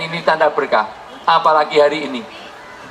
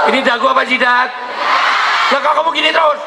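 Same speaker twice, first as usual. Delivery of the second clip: teasing shout; 5 semitones higher, faster than usual. Real, not acted. Teasing congregation who touched forehead.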